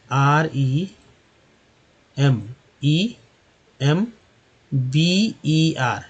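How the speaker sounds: बी ई आर spelled out letter by letter, very slowly; letters spelled at a crawl for the class; slow spelling. Shown as a demonstration. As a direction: drag out each letter of बी ई आर. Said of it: "अब देखिए ये तीन ई है".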